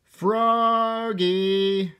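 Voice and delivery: high, drawn-out call. A narrator's reading. Mother calling Froggy in to swim, high long call. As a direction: sing out the name to fetch a child. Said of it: "called his mother".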